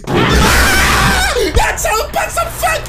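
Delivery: shrieking high and loud with a laugh; real scream of fright, laughing after; terrified scream. Real, not acted. Jump scare, screaming. (hurlement de peur)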